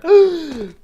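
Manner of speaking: sharp high shocked gasp; dramatic gasp. Acted. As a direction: gasp in mock horror. Reacting to the one-star rating.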